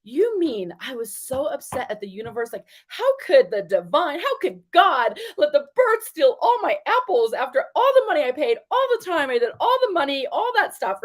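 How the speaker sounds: high, indignant complaint; upset inner rant. Retold or imitated. Retelling her own upset thoughts about the birds, high and indignant. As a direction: protest to God about the stolen apples. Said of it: "I was thinking to myself".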